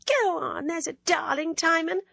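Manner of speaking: a coquettish schoolgirl needling her friend; teasing Ginevra. Acted.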